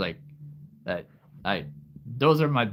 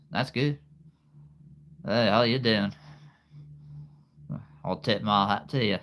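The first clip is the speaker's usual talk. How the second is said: higher-pitched Southern US greeting; Southern US accent; 3 semitones higher than usual. Shown as a demonstration. Greeting y'all at Christmas, Southern US accent. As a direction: call out to y'all with a Southern twang. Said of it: "a Southern U S A accent".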